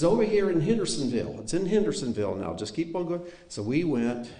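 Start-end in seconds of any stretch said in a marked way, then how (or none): 0.0-3.2 put-on Aunt Royce Tennessee accent. Nephew imitating his aunt inviting them, eager Tennessee accent. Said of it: "the strongest Tennessee accent".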